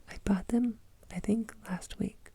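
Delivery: usual speaking voice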